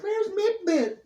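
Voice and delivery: high worried neighbor voice. Retold or imitated. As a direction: call for the lost cat. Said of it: "was always like".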